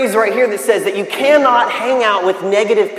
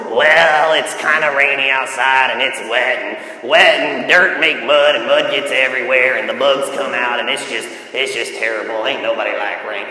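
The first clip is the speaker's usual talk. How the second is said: mock complainer, sagging lower as it goes; complaining negative person; 9 semitones lower than usual. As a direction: grumble on drearily in a put-on low voice. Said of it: "They start complaining about the rain".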